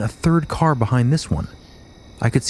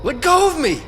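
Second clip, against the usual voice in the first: much higher, frightened cry; cracked with fear. Retold or imitated. Husband crying out in fear to the beings holding him. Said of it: "His voice was all sort of cracked up with fear".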